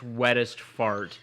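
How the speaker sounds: usual speaking voice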